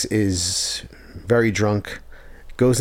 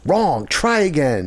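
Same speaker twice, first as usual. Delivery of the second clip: higher, drawn-out mock scolding; mimicked scolding; 7 semitones higher, slower than usual. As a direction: slowly correct someone, imitating her. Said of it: "she goes".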